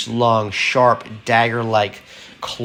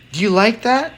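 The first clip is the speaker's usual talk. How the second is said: high, teasing question; young woman's voice; 11 semitones higher, faster than usual. A narrator's reading. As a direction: coax him in a high voice.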